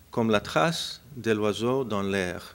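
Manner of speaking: slow, careful French reading of Comme la trace de l'oiseau dans l'air; French title. Shown as a demonstration. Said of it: "forgive my French".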